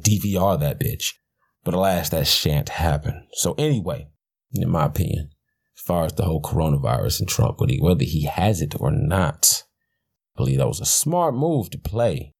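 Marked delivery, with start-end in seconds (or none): none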